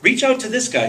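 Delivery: high, eager imitated recommendation; eager friend imitation. Retelling how Billy Tucci pitched the Madman, high and eager. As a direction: pitch way up, gushing about a friend. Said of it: "And Billy Tucci goes".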